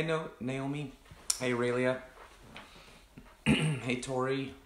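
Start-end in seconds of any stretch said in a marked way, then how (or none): none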